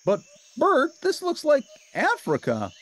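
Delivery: very high, loud joyful cry; Ernie crying out. A narrator's reading. Ernie seeing Bert is safe, high joyful shout.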